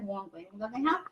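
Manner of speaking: normal talking voice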